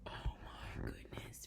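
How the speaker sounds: slow, breathy whisper; reacting to the picture in a whisper; awed whisper. Real, not acted. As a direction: marvel quietly. Awed aside.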